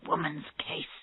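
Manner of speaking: very soft, whispered grumble; muttering to herself. A narrator's reading. Tennyson to herself after Kernan's remark, whispered resentment. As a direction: say it under your breath, resentfully. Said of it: "she said to herself, still angry at Kernan's remark".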